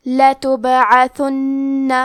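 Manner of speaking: لتبعثن recited slowly with the doubled نون held for two beats; tajweed recitation. Shown as a demonstration. Teacher demonstrates the word لتبعثن slowly to the student. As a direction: draw out لتبعثن, nun held long with ghunna. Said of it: "دو حرف کے برابر نون".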